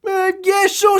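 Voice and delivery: very high-pitched hesitant yes; mock casual agreement. Swordswoman agreeing to join the fight, mock casual agreement.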